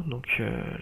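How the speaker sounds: usual speaking voice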